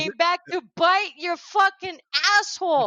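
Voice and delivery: high-pitched imitated rant; Michael Rapaport. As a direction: act out an outraged warning, relishing each curse. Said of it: "and then he goes".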